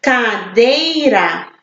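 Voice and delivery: Cadeira slowly split CA, DEI, RA; slow Cadeira, each syllable set apart. Shown as a demonstration. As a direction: say Cadeira in three separate beats. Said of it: "Três sílabas".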